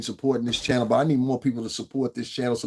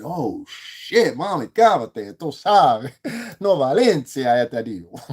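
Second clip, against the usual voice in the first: playful Spanish; 4 dB louder, slower than usual. Retold or imitated. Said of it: "I said".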